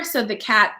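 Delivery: ordinary delivery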